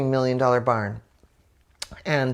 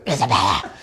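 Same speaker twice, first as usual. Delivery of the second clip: mom whisper yell; 6 semitones lower, faster than usual. Shown as a demonstration. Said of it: "it's the mom whisper w-, yell, exactly".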